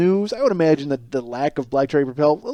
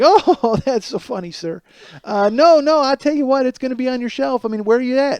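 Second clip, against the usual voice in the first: a touch higher, bright chirpy customer-service voice; cheerful phone rep; 7 semitones higher than usual. Retold or imitated. Caller imitating cheerful Gatorade rep, mildly chirpy. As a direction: play the sweet, upbeat operator lightly. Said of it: "she's very cheerful".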